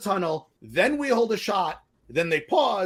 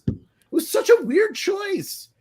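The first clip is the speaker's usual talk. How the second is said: exasperated; 12 semitones higher than usual. Real, not acted. Exasperated movie critique.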